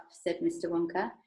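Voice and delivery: plain reading voice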